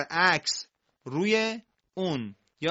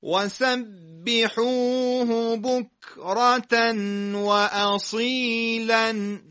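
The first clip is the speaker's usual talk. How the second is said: slow chanted verse, higher and louder; teacher chanting a verse to repeat; Quranic recitation; 5 semitones higher, 3 dB louder, much slower than usual. Shown as a demonstration. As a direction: recite slowly with melodic tartil. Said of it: "یک عبارت رو با هم دیگه بخونیم".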